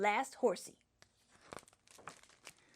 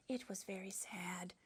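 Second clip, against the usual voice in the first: soft, mournful hush; a hushed sad aside; 4 semitones lower, 14 dB quieter than usual. Acted. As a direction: sigh the line out softly. Sad princess story line.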